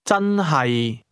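真係 starting high and level, then dropping low; high-to-low tones. Shown as a demonstration. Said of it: "starts with a high level tone, then drops".

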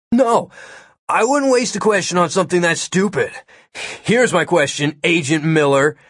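stiffly indignant. A narrator's reading. Kid refusing to waste his question, stiffly indignant. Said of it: "Todd stiffened".

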